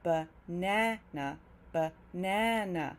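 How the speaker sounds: slow "banana", long strong middle "na"; stressed middle syllable. Shown as a demonstration. Teacher demonstrating banana's reduced sounds, middle syllable stressed. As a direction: stretch the middle of "banana", weaken the other two. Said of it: "reduced and weaker than that middle na".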